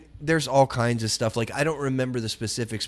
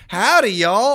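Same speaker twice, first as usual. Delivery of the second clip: higher, louder cheery howdy; loud hillbilly greeting; 10 semitones higher, 9 dB louder, slower than usual. Retold or imitated. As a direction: holler a folksy howdy at passing cars. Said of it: "they say".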